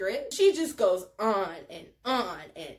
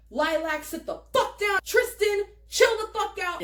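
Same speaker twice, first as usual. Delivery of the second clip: raised, higher-pitched scolding; stern intervening; 7 semitones higher than usual. Retold or imitated. Driver Randy breaking up a back-seat fight, raised scolding voice. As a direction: order two squabblers to settle down. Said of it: "Randy's like".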